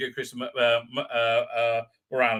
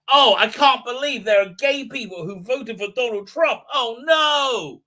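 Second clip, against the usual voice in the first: mock outrage; 6 semitones higher, 6 dB louder than usual. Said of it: "It's like".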